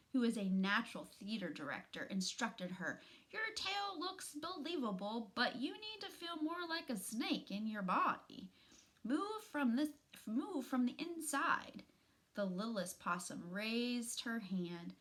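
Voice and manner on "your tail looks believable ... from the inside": teacher voice. Acted. Mama Possum directing acting.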